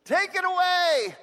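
high, slow, desperate plea to God; Paul crying out to God to remove his thorn, pleading. Retold or imitated. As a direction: cry it out like a begging prayer. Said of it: "Three times I pleaded with the Lord".

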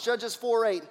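ordinary talk, nothing marked

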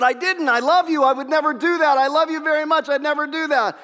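high, earnest pleading; pleading husband. Retold or imitated. Husband pleading with jealous wife.

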